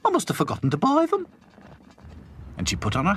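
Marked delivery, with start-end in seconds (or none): 0.0-1.3 high, fast dotty cook voice; Florrie. A narrator's reading. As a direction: rattle it off to herself.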